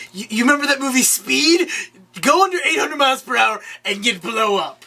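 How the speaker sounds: high-pitched retold villain threat; mock excited threat. Villain tells hero about the bomb, mock excited threat. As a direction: pitch it up as a hammed-up taunt.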